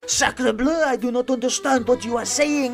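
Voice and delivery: high mock French accent; a hammy French chef accent, slow at the end. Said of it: "It's usually something like".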